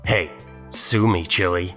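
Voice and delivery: usual speaking voice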